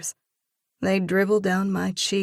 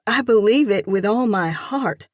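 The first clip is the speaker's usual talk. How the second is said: louder, voice quivering with faith; trembling with conviction; 4 semitones higher, 7 dB louder than usual. A narrator's reading. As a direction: declare it with passionate conviction. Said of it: "my voice trembling with conviction".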